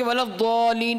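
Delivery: chanted with the madd held; Quranic recitation. Shown as a demonstration. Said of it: "پڑھ لیا، پھر بھی معنی صحیح ہو جائے گا". (قرآنی ترتیل میں مد کے ساتھ)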